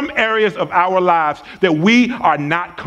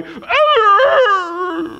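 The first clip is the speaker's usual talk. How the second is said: dog's pained howl; 14 semitones higher than usual. Retold or imitated. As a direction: let out a dog's cry of pain. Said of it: "the dog go".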